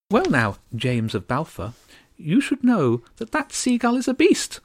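high regal voice, spitting contempt; bitter tiny countess. Acted. Starling countess warning James about the seagull, bitter. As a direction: sneer at the seagull. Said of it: "said bitterly".

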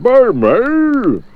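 high, loud friendly elephant. Acted. Elephant offering Mister Daydream a lift, high voice. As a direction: offer the ride, high and bright.

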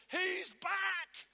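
high, loud joyful shout; Peter's shout. Acted. Peter at Pentecost shouts for joy, loud high shout. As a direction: burst out with joy. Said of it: "I'm sure Peter shouted".

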